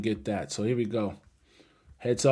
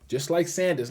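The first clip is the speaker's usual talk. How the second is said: ordinary talk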